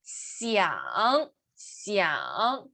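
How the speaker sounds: Xiang drawn out, dipping low then rising; third tone. Shown as a demonstration. Teacher showing third tone, Xiang low and dipping. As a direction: sink and lift on "Xiang". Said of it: "Tercer tono".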